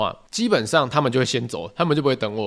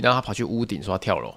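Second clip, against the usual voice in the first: ordinary delivery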